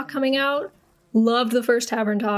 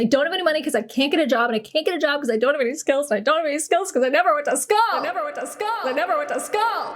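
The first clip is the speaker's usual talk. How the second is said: fast, higher-pitched circular rant; rapid comic loop; 3 semitones higher, faster than usual. Pretend. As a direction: rattle it off like a stuck loop. Looping comic excuse chain, repeated.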